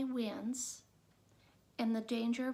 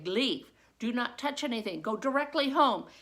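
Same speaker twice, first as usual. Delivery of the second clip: loud, clipped commands; teacher sending the class home firmly; firm orders; 8 dB louder than usual. Retold or imitated. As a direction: get them out now.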